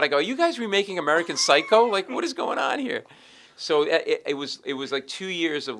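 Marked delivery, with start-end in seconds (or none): 0.2-3.0 loud, high-pitched disbelief; retold incredulity. Lawyer to bloodthirsty colleagues, high incredulous outburst. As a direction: protest in baffled mock outrage.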